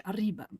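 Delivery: "arriba" with a rolled Spanish R. Shown as a demonstration. Streamer comparing southern Portuguese to Spanish, "arriba" with rolled R. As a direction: roll the R in "arriba". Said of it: "the R is like the Spanish".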